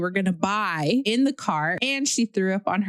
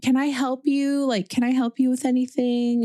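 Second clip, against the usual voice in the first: sweet lady; 6 semitones higher than usual. Retold or imitated. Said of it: "She was like".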